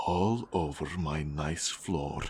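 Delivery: ordinary delivery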